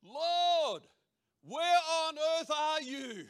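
high, loud, drawn-out cry; yelled-out prayer. Retold or imitated. Preacher re-enacting his own yelling at the Lord, shouting. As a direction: bellow the question up at God. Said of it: "yelled out".

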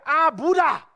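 mock temper yell, high and loud; angry yell. Preacher mimics people losing their temper, yelled. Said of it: "lose their temper and they yell".

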